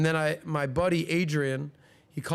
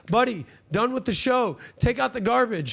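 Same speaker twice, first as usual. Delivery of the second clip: higher, louder mock order at the busboy; retold yelling; 8 semitones higher, 5 dB louder than usual. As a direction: bark it like a boss catching him slacking. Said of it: "I got yelled at four times".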